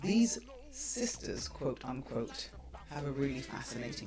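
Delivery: soft, intimate sponsor read; breathy vintage purr, coaxing the audience. Acted.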